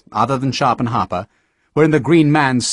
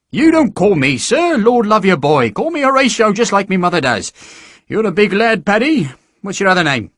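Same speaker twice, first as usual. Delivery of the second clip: higher, lightly voiced hearty sergeant; jovial recruiting sergeant; 8 semitones higher, 3 dB louder than usual. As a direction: charm the lad into signing up.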